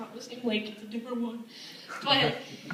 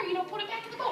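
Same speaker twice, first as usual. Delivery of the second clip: high, softer coaxing; patient request; 9 semitones higher, 6 dB quieter, much faster than usual. Retold or imitated. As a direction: coax a child nicely in a high voice. First, patient take of the order.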